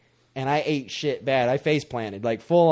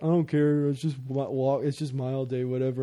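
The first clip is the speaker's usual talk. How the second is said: low, quiet couldn't-care-less mumble; imitated indifference; 3 semitones lower, 4 dB quieter than usual. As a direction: shrug it off like slacking classmates.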